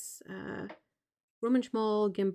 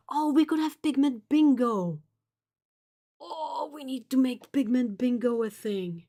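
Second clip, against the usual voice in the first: excited; 4 semitones higher, 6 dB louder than usual. Real, not acted. Excited new idea.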